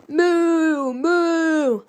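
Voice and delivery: high, loud mooing cows. A narrator's reading. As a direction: moo like startled cattle. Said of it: "mooed the cows".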